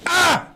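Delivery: short, high-pitched yelp; mock stab cry. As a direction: cry out as if suddenly stabbed, hammed-up. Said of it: "He stabs him".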